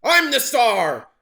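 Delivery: high, loud, slow scream; jealous shriek. A narrator's reading. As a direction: shriek with wounded pride. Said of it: "He shrieked".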